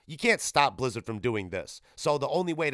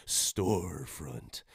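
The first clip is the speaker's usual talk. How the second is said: very slow, low 'Storefront'; drawn-out word; 8 semitones lower, 5 dB quieter, much slower than usual. Real, not acted. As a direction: stretch out 'Storefront', dropping low and soft. Savouring the word just found.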